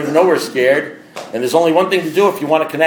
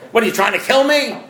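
pitched up high in disbelief; hammed-up alarm at a drink offer; mock outrage; 8 semitones higher than usual. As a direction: act shocked someone would offer.

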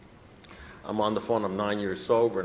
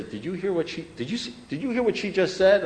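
higher-pitched, worked-up questioning; agitated sponsee; 8 semitones higher than usual. Retold or imitated. Sponsees complain to him about a remark, imitated agitation. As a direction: imitate someone scandalized, stumbling to get it out.

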